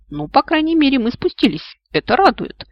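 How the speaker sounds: hesitant optimism. A narrator's reading. Optimism to the gloomy brain, tentative hopeful voice. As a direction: try to sound cheerful, unsure. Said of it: "попытался заикнуться природный оптимизм".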